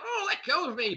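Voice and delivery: high-pitched acted goblin cry; screaming goblin. Game master voicing a goblin struggling free, mock scream. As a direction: cry out high, without much added volume. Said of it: "he s-, screams out".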